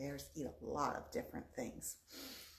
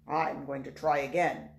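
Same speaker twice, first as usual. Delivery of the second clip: wolf voice; 3 semitones lower, 10 dB louder than usual. Acted. Wolf determined.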